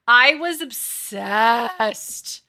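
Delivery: stretched slow and loud, every word leaned on; really milk the obsession; drawn-out gush. Real, not acted. Confessing a childhood obsession to chat, gushing slowly and loudly.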